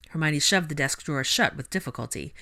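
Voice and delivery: ordinary delivery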